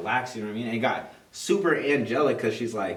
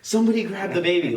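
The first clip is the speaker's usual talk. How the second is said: retold yell; 8 semitones higher, 7 dB louder than usual. Wife in labor, yelling for help.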